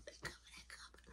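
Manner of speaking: whispered "they coming, they coming"; hushed warning. Retold or imitated. Warning his friends that someone is coming down the stairs, whispered. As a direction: warn them without being heard. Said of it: "do little language".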